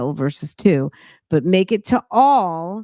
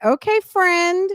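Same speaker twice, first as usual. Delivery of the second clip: sarcastic; 6 semitones higher, slower than usual. Retold or imitated. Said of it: "I'm like".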